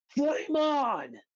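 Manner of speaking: very high heroic yell; hammed-up superhero catchphrase shout; battle cry. Acts Johnny's battle cry.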